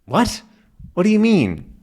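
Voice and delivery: high, puzzled question; baffled, asking what he means; mild mock confusion; confused. Both confused.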